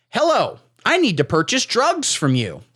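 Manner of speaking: high bright opener, then slow and careful; mock Tucker Carlson. Tucker asks a dealer for drugs, hammed-up and polite. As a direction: lay the polite request on thick.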